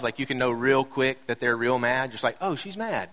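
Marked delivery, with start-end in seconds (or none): 2.4-3.1 high, soft sing-song "uh-oh" tone; quietly clock that someone's in a mood, pitch swooping; wary realisation. Acted. Acted wary realisation about wife.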